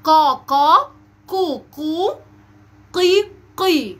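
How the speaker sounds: loud, drawn-out QAF syllables "قا، قو، قي"; qaf syllable drill. Shown as a demonstration. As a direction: model "قا، قو، قي" slowly with a heavy back-of-tongue q. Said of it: "دي المقاطع الصوتية".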